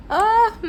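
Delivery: high gasp into a whimpering laugh; half laughing, half crying from spice. Real, not acted. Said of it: "I'm sweating".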